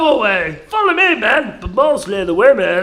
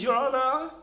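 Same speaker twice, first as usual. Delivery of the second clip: ordinary delivery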